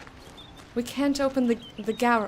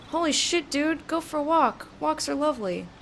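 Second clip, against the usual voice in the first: excited outburst; 7 semitones higher than usual. Real, not acted. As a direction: shout at yourself to go outside. Gamer overwhelmed, urges himself outside.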